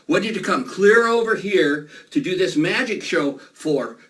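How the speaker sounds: ordinary talk, nothing marked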